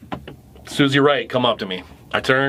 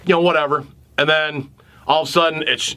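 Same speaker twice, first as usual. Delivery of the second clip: normal talking voice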